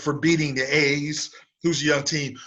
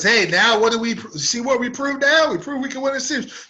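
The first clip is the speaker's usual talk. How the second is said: high, sneering mimic of a bragging player; mock him like a gloating fool; taunting imitation, pitched up and loud; mocking quote; 9 semitones higher, 3 dB louder than usual. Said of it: "This idiot comes on and says".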